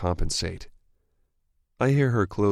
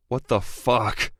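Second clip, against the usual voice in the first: slow, louder frustrated curse; frustrated growl; 6 semitones higher, 3 dB louder, slower than usual. A narrator's reading. Darius to Evie about the trade, frustrated growl. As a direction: let the frustration out. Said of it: "I growl with frustration".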